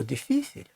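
«діфісіль» з наголосом на друге «і»; наголос на передостанній склад. Shown as a demonstration. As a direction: say «діфісіль» as di-FI-sil, middle syllable stressed. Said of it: "не відповідає правилу". («діфісіль» як діФІсіль)

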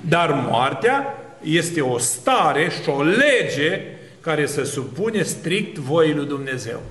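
normal talking voice

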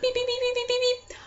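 high beeping like a phone; imitated phone beep. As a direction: mimic a ringing phone beep. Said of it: "it just does the beep".

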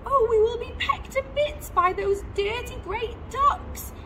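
high, loud wail of alarm; dramatic storybook fretting; alarmed cry. A narrator's reading. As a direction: wail in a high mother-bird voice. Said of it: "cried Missus Gregg".

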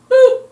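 sudden loud high "boop" sound; high squeaky boop. Acted. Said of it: "do my boop more often".

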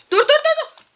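high, loud frantic yelling of stop; panicked stop. Real, not acted. Gamer yelling at an attacking mob, high frantic panic. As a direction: beg the mob to stop in a rush.

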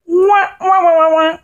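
mock wail.

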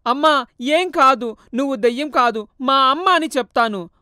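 son refusing to let go; earnest insistence; urgent insistence. Acted.

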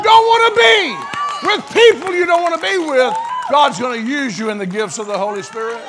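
loud, high, fervent preaching at the climax; preaching at full force. Acted. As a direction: drive it home to the congregation.

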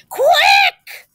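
very high, loud urgent yelp; crying out. A narrator's reading. Third pig warning his brothers, high urgent cry. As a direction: shout it as an urgent alarm. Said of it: "cried the third little pig".

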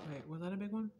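ordinary talk, nothing marked

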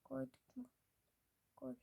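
ordinary talk, nothing marked

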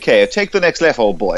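posh sat-nav voice, dropping at the end; posh accent. Acted. Sat nav in a posh area, posh accent. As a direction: put on an upper-crust posh accent. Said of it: "you go in a posh area".

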